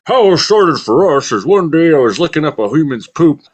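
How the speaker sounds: bear voice. Retold or imitated. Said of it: "they're saying".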